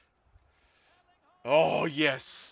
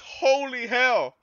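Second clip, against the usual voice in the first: ordinary talk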